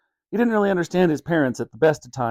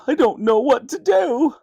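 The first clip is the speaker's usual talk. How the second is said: high, helpless tearful voice; a father near tears at a loss; 11 semitones higher than usual. Acted. Dad sobbing, son missing.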